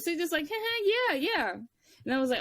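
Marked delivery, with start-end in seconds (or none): 0.5-1.5 high, forced chuckle and agreement; polite fake laugh. Not wanting to be rude to the intruder, politely fake. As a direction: humour them with a fake little laugh. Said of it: "you don't wanna be rude".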